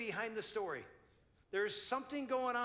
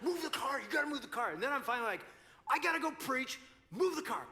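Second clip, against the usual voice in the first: higher, louder urgent command; insistent order; 6 semitones higher, 4 dB louder than usual. Retold or imitated. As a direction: push her to move it now. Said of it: "I'm like".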